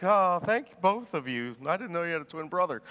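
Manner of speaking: mock concussed daze. Concussed patient thanking two doctors, mildly mock-dazed. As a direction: play at being dazed and grateful. Acting concussed self seeing double.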